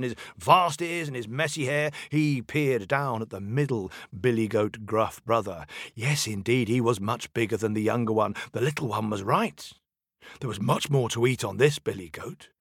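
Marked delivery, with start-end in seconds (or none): none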